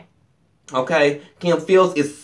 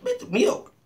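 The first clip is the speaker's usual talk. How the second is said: squeaky mock demand; 4 dB quieter than usual. Acting out the cow bit.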